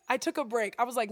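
ordinary talk, nothing marked

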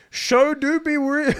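high, slow silly voice; jokey high meme delivery. Acted. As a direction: stretch it out in a goofy high voice. Reading meme phrasing.

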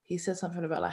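ordinary talk, nothing marked

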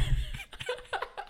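high airy giggle; high laugh. Real, not acted. Laughs at own failed joke.

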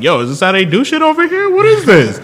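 very high-pitched, incredulous protest; mock-outraged high-pitched quote; indignant disbelief. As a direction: squeal it like someone wrongly shot at. Acting out character shot at by cops.

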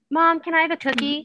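put-on high, pleading kid voice; child asking. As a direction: ask sweetly like a little kid wanting a treat.

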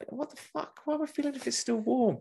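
can't believe what he's asking; incredulous. Retold or imitated. Telling a friend how the seller's request baffled him, shrill disbelief.

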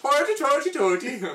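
squeaky high mock Irish voice; mock Irish accent. Mocking Irish accent on streetcar.

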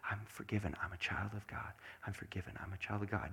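low, hushed self-reminder; very quiet, private murmur; muttering to oneself. Shown as a demonstration. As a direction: repeat it softly under your breath. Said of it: "you mutter them to yourself".

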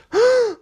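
sharp, high, loud gasp; startled gasp. A narrator's reading. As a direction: suck in air in surprise. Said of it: "Rubble and Rocky gasped".

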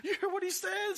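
very high, hammed-up excited squeal; excited fight fan. Fan reacts to fighters' trash talk, mock excitement. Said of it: "That's what gets people excited".

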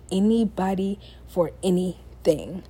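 normal talking voice

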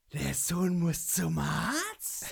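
slow, drawn-out acted question; acted movie line. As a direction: deliver it like a menacing film character. Acting out film character's line.

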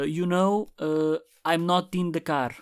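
slow, exaggerated Portuguese-accented English; fake Portuguese English accent. Podcaster joking to listeners, slow mock Portuguese-accented English. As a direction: ham up a bad English accent on purpose. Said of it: "isto não é a minha pronúncia a sério".

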